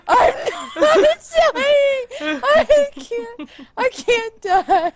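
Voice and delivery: high, drawn-out cry mixed with laughter; panicked wail. Real, not acted. Panicked while chased in game.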